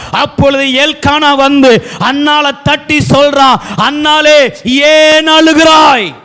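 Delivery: high, ringing sermon storytelling; full-force preaching. Acted. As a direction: call the quoted words out to the congregation.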